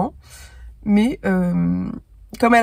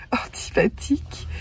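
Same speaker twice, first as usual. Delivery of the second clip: slow giggly whisper; laughing whisper; 5 semitones higher, slower than usual. Real, not acted. Describing the unlikeable heroine to viewers, laughing whisper. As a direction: breathe the word through a laugh.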